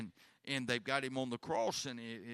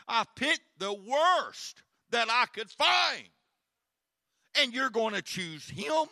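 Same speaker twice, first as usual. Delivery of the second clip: loud, higher, slowed cry of disbelief; exasperated shout; 12 semitones higher, 10 dB louder than usual. Acted. Pilate pleads with the crowd, shouting in disbelief. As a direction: throw it at the crowd in outrage.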